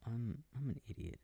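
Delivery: normal talking voice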